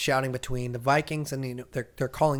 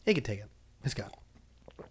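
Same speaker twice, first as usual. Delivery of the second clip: usual speaking voice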